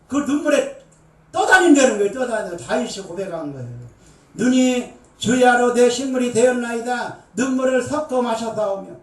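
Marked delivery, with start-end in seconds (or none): 4.3-8.9 preacher reading psalm aloud; fervent scripture reading. Acted. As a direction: intone the psalm with weight. Reciting psalm.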